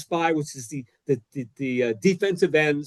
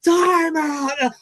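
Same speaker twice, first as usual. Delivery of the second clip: repeated timeout yell; 12 semitones higher than usual. Retold or imitated. Coach yells to the official, screaming time out. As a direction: bellow the call a second time. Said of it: "I go".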